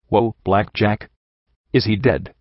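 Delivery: a touch of casual amazement; impressed. A narrator's reading. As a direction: take it in with understated awe. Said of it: "sounding impressed".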